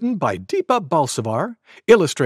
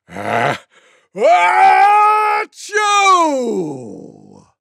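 shouted sneeze trailing downward; loudest pretend sneeze; 13 semitones higher, 6 dB louder, much slower than usual. A narrator's reading. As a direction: wind up into long, high achoo. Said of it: "the loudest sneeze you could ever imagine".

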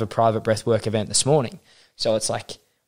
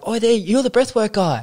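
mock fan greeting; 11 semitones higher, 4 dB louder than usual. People greeting him, mocking tone.

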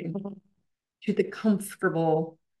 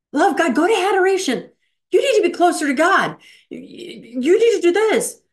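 raised, urgent nagging voice; pushy insistent wife; 9 semitones higher, 6 dB louder than usual. Retold or imitated. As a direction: press him hard, insistent and loud. Devout wife pushing husband.

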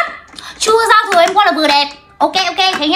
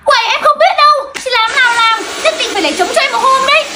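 high, pushy phone wheedling; insistent pleading; 6 semitones higher than usual. Acted. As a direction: demand it in a raised pitch. Insisting on a salon booking by phone. (nài nỉ cao giọng)

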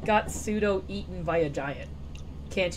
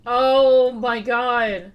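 loud, slow, stretched out; excited; 8 dB louder, much slower than usual. Real, not acted. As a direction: draw it out in delight. Excited at finding apparatus.

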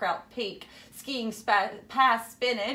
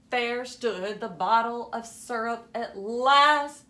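ordinary delivery

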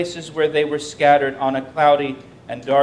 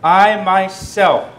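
I myself, slow, higher and louder, each word hammered; very emphatic; 4 semitones higher, 8 dB louder, much slower than usual. Shown as a demonstration. Preacher showing the emphatic Hebrew, I myself stressed slow and loud. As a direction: punch out I myself slowly. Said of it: "it's very emphatic".

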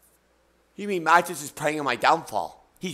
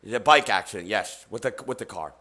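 mock ASMR; 8 semitones lower, 4 dB quieter than usual. Continues mock ASMR hosting voice.

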